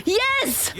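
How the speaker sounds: real joyful yell; excited shout. Real, not acted. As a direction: shout yes in delight. Streamer's friend appears in game.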